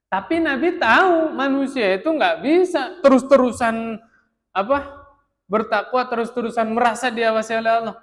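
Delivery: loud, high, fervent sermon delivery; impassioned preaching. Real, not acted. Preacher rousing the congregation about human weakness, loud and high. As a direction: drive the point home to the hall.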